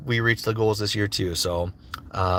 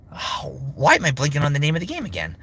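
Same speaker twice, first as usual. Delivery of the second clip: ordinary talk, nothing marked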